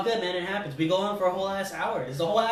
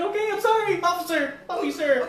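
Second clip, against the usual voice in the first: much higher begging voice; pleading apology; 9 semitones higher than usual. Retold or imitated. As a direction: squeak out a scared sorry. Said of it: "And he's like".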